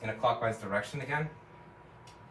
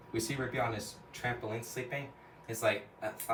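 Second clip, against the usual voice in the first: ordinary talk, nothing marked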